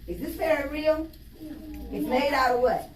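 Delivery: plain reading voice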